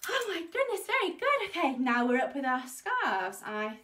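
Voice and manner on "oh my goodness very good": delighted. Real, not acted. Teacher delighted after fast song.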